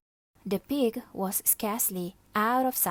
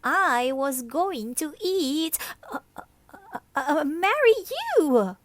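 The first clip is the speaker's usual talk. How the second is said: rage, then catch yourself; furious lizard; 9 semitones higher, 7 dB louder than usual. Acted. The livid lizard almost admits he would eat her, high and loud.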